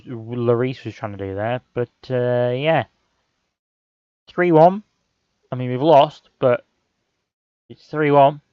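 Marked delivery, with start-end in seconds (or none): 4.3-4.8 excited call. Real, not acted. Celebrating consolation goal.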